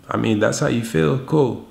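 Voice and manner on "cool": usual speaking voice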